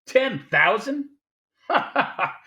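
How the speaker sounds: loud mocking laugh, high and booming; scornful laughter. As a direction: laugh the offer off as petty. Gutman scoffs at Cairo's offer.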